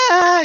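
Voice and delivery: loud high mock shriek; the daughter screaming as her dad eats it; imitated child's scream. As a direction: shriek like a terrified little girl. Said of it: "She's screaming even more".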